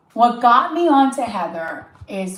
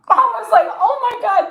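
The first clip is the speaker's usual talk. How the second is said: loud, high-pitched, cracking up; laughing disbelief; 13 semitones higher, 6 dB louder than usual. Real, not acted. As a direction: squeal it through your laughter. Laughing retelling of shocked reaction.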